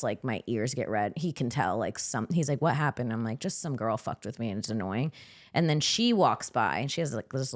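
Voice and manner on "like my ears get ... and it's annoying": low, quiet annoyance. Real, not acted. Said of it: "when I'm pissed".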